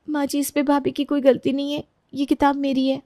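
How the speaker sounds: high, meek admission; timid daughter-in-law, confessing. Acted. Younger daughter-in-law confesses to mother-in-law, high and meek. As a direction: own up timidly.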